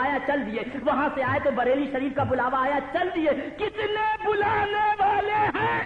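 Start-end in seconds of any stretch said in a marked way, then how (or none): 3.6-5.8 ecstatic shout. Acted. Preacher crying out in rapture to the crowd. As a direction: cry it out in rapture.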